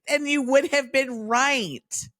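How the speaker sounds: high, drawn-out retort; mock exasperation. Quizzer replying to friend's late guess, mock exasperated. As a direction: stretch it out high at a too-late answer.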